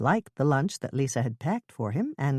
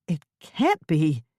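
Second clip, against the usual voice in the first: shocked exclamation; 3 dB louder, slower than usual. Acted. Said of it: "she exclaimed aloud".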